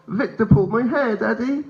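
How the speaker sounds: put-on high child's voice, rising at the end; little boy. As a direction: play a hurt small son telling Daddy. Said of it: "And he said".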